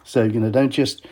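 usual speaking voice

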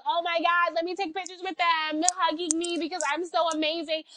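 hammed-up saviour voice; mocking volunteer impression. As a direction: gush with fake pity and self-congratulation. Mocks self-satisfied volunteers in their voice.

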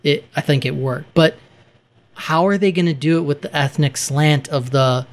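normal talking voice

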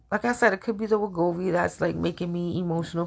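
ordinary delivery

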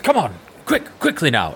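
high, stammering guard backing down; hastily find someone else to help; intimidated guard. Acted. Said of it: "his resolve starts to crumble".

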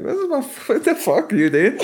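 high, laughing outburst. Real, not acted. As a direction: blurt it out high while laughing. Retelling his reaction.

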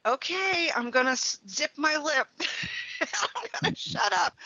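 sheepish backing down. Retold or imitated. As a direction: shrink into a tiny chastened voice, half laughing. Meekly backs down after the sign.

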